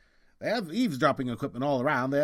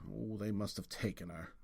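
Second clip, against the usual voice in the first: hushed dread; 4 semitones lower, 12 dB quieter than usual. Acted. Realises girl taken.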